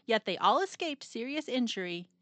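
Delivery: usual speaking voice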